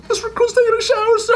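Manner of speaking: very high, quick mock-recruit reply; recruit answering. Recruit explains himself to drill instructor, high-pitched mock report. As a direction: squeak out a hammed-up report to a superior. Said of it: "you speak in third person".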